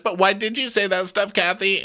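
high, hurt housewife whine; mock wounded, high-pitched; imitating Kyle. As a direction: ask in a high put-on voice. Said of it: "She's like".

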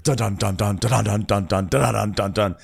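rhythmic spoken drumbeat; imitated pounding drums. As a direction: pound out the syllables in steady rhythm. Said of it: "there was, like, pounding drums".

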